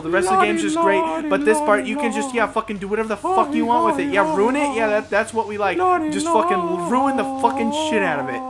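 loud sarcastic imitation of a lazy boss; mock developer. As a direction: sneer it as the boss handing off the job. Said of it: "and you're like".

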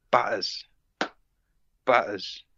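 mild London accent, barely put on. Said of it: "try and do it in a London accent".

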